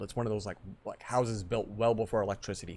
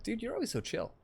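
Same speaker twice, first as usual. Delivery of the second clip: retold remark to buddy; 12 semitones higher, 5 dB quieter than usual. Quoting himself to buddy.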